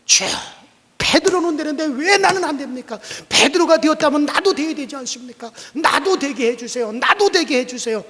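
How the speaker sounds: high, loud pleading prayer; earnest prayer. Acted. Pastor pleading with God again, loud. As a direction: cry out to God, begging. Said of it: "간절히 기도".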